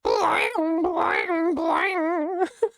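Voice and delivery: high nonsense trumpet imitation with lips; vocal trumpet riff sung along. Real, not acted. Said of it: "hear me trumpeting to myself nonsensically".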